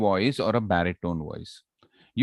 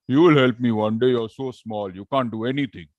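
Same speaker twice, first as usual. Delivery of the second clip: lion; 4 dB louder than usual. Acted. Lion mocks the mouse.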